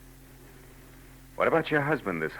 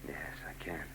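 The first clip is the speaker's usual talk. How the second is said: breathy hushed yes; soft whispered reply; 6 semitones lower, 15 dB quieter than usual. Acted. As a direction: answer her in a whisper. Tender reply during confession.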